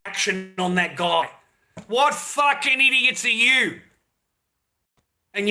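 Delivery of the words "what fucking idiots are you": raised and slowed, spitting each word; a furious rhetorical jab at his viewers; angry outburst. Real, not acted.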